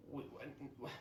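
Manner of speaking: ordinary delivery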